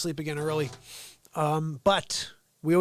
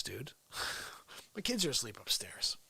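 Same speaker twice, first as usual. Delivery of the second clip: normal talking voice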